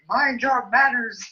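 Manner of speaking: high, slow, drawn-out reprimand; mock scolding mom. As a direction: imitate a mother telling off a child. Imitating her mother's reprimand.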